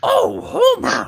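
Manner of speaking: very high, loud, drawn-out cartoon wife; exasperated cartoon wife calling her husband; Marge Simpson. Performs on request for the co-host, cartoon impression. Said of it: "Can you do a Marge Simpson?".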